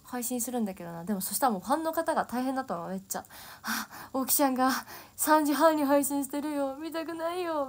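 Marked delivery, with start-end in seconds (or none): 3.6-7.7 higher, louder mock fan complaint, slowing; mock fan grumbling, higher and louder; imitating a tired fan. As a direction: play a worn-out fan groaning at a 3:30 stream. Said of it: "みたいな".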